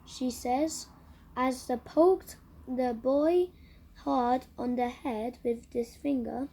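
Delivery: ordinary delivery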